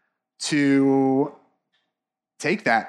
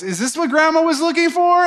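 put-on high, innocent kid's voice; a little girl innocently asking her mother; innocent child; 10 semitones higher than usual. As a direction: ask it like a guileless little girl. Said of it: "Deborah's daughter comes in and goes".

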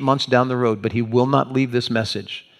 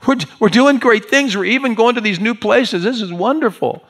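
high, louder, agitated protest; upset disciple objecting, pitched way up; heated Peter; 11 semitones higher, 4 dB louder than usual. Acted. Peter upset at Jesus' kind of talk, heated protest. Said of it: "Peter is heated".

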